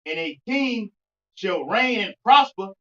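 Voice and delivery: forceful verse reading, key words drawn out; scripture reading. Real, not acted. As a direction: proclaim the verse phrase by phrase, loud on the big words. Rereads the verse aloud.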